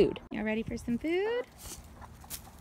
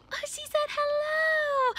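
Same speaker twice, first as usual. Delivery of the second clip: much higher, breathless wonder; amazed gasp; 12 semitones higher, slower than usual. Pretend. Cat answered, teacher gasps.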